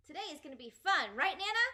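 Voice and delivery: fast, high and loud; bouncy excitement. Acted. Child skipping around Nana, thrilled. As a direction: skip through the words.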